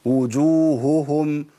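وجوههم with both ه clear, hu-hum; correct double h. Shown as a demonstration. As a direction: sound each ه in وجوههم. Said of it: "لا". (وجوههم صحيحة)

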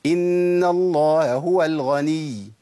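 إن الله هو الغني with the ه of الله and هو both pronounced, slow; slow recitation, each ه sounded separately; both h sounds clear. Shown as a demonstration. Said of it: "سمعتوا هائين؟". (نطق الهاءين بوضوح في الله هو)

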